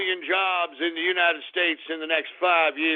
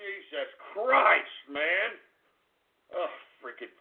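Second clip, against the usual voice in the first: ordinary delivery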